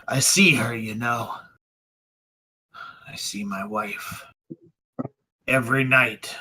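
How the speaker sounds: hushed, half-whispered confession with long pauses; defeated, breathy and slow; haunted grief. Acted. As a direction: speak brokenly of a dead wife's ghost. Broken magistrate slumps, confesses guilt.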